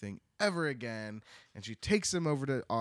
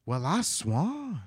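drawn-out, slightly higher folksy exclamation; rustic aunt; 4 semitones higher, slower than usual. Acted. Country aunt reacting to the sight, drawn-out mock surprise. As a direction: stretch it out like a startled country aunt.

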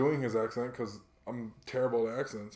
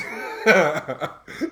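loud laugh; 4 semitones higher, 10 dB louder than usual. Real, not acted. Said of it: "Of course it is".

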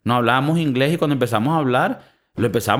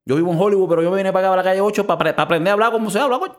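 mock Cuban Miami accent; 5 semitones higher, faster than usual.

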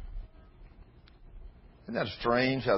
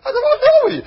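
squealed high through laughter; a high, laughing squeal; 13 dB louder than usual. Retold or imitated. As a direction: ask it while cracking up, voice going high. Retold question through laughter.

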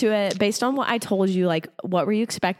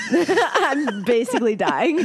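laughing; 9 semitones higher, slower than usual. Real, not acted. Laughs at her own illness.

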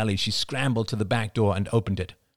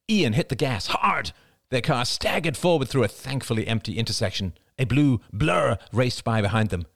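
plain reading voice

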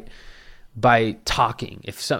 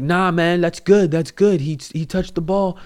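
higher, louder worked-up arguing; heated protest; 7 semitones higher, 4 dB louder than usual. Retold or imitated. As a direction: insist hard that no one called it. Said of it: "he's charged".

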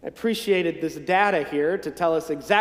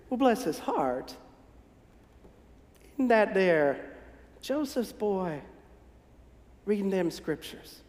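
gossiping neighbor; 5 dB quieter than usual. Acted. Townsfolk gossip about Jesus.

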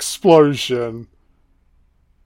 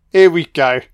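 ordinary talk

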